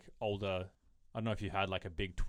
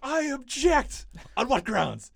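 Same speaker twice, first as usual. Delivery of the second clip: high, loud, manic courtroom voice; Jim Carrey impression; 12 semitones higher, 8 dB louder, slower than usual. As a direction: ham it up wildly. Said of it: "liar, liar".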